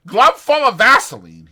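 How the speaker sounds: Candy joining the jeering, disgusted. Acted. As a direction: spit it out in disgust. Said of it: "he said disgustedly".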